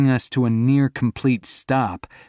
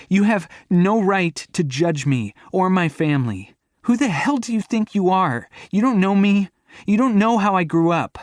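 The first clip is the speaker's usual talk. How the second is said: much higher, louder furious shout; 10 semitones higher, 4 dB louder than usual. Acted. To her boyfriend who judged her family, furious. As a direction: stress on don't. She charges at him furious.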